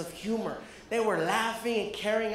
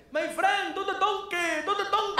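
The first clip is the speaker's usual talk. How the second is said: yelling fans; 10 semitones higher than usual. Retold or imitated. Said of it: "men start yelling my jokes at me".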